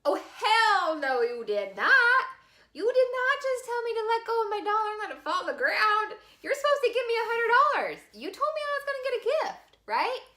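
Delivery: high, loud indignant wail; outraged disbelief. Retold or imitated. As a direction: protest the lost dollar in mock outrage. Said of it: "And I went".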